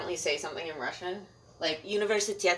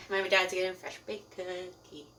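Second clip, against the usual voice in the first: ordinary talk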